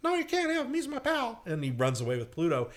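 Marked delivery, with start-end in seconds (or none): 0.0-1.4 very high protesting cartoon falsetto; Mickey Mouse. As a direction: refuse in a squeaky little hero voice. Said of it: "And Mickey goes".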